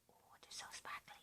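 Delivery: breathy whisper of delight; whispering in awe. Real, not acted. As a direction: whisper it like it's too pretty to say aloud. Admiring sparkly needle minders.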